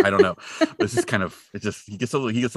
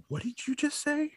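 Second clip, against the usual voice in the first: high put-on voice of a shocked father; hushed, high mock outrage; mock stern dad; 9 dB quieter than usual. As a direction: pretend to catch a kid swearing. Dad catching Ralphie's swear.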